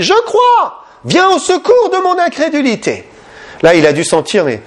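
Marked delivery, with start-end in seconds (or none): 0.0-3.0 desperate cry. Retold or imitated. Father crying out to Jesus for help, high desperate plea. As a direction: cry out to be rescued from doubt, voice climbing very high. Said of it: "il a crié".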